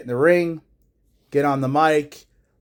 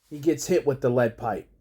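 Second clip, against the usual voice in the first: usual speaking voice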